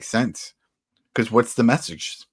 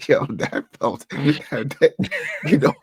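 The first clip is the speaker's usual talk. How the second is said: laughing; 4 semitones higher, 3 dB louder, slower than usual. Real, not acted. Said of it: "Why are we laughing?".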